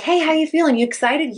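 high, upbeat imitation of a coach; coach asking. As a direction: check in on the athlete cheerfully. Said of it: "your coach is like".

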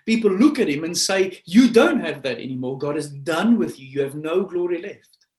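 loud, high-pitched sermon crescendo; forceful preaching. Acted. Preacher voices people writing David off, forceful preaching.

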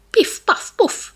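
high, loud piff paff puff; comic shooting noises; sound effect. A narrator's reading. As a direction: pop out each shot like a toy gun.

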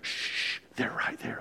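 soft unvoiced shush; whispered shush. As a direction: hush someone urgently in a whisper. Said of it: "they'll be like".

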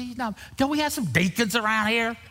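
high, indignant pleading; mock whining protest. Arguing Sam off onto the deacons, whiny indignation. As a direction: push the voice up into a comic objection.

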